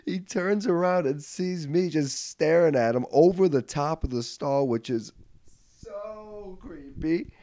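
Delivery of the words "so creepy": high, soft, drawn-out squeal; slow falsetto cringe; creeped out. Pretend. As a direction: stretch it out in a tiny high voice. Mock-creepy comment on himself.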